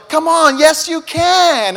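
high, loud, slow coaxing; pushy cheerful instructor. Retold or imitated. Ski instructor coaxing Preston, loud and high. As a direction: coax a scared kid downhill. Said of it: "ski instructor's over here going".

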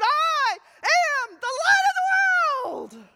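climactic proclamation. Acted. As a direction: cry it out, stretched and ringing. Climactic declaration of Jesus.

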